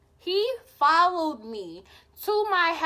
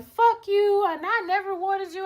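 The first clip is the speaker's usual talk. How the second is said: higher, louder mock angry ex; 8 semitones higher, 3 dB louder than usual. Ex storming off, mock angry shout higher. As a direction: imitate his bitter parting shout. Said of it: "And he was like".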